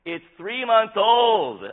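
very high, very loud, drawn-out complaint; exasperated wife. Retold or imitated. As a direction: imitate a wife wailing slowly at the top of the voice. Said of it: "She's like".

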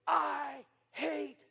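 slow, high furious yell, word by word; angry outburst. Retold or imitated. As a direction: imitate a mad camper, exploding on the last word. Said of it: "he's just mad".

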